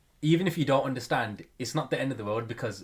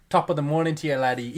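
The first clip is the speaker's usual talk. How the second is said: hammed-up Irish accent, louder and higher; mock Irish greeting; 4 semitones higher, 6 dB louder than usual. Greeting the Irish customer in a mock Irish accent. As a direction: greet him with a stage Irish lilt. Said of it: "an Irish guy that comes to the shop".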